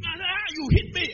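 high, loud outraged cry; angry accusation. Retold or imitated. Big student accusing him in the crush, loud mock outrage. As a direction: mimic an aggrieved bully, shouting. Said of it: "the guy got very angry".

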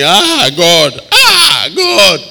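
loud, high, hammed-up wail; exaggerated agonized outcry; mock crying to God. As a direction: wail to God in comic dismay. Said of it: "you were thinking".

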